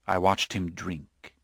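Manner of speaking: plain reading voice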